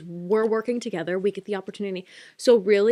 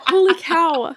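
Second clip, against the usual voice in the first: much higher, louder startled exclamation; surprised outburst; 9 semitones higher, 8 dB louder, slower than usual. Real, not acted. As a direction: blurt it out, caught off guard. Stomach noise interrupts her story.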